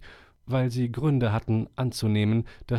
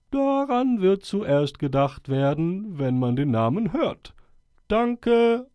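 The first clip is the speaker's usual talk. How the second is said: put-on higher, solemn old owl voice; 7 semitones higher, 4 dB louder than usual. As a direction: speak like a wise old owl addressing the forest. Owl speaking again.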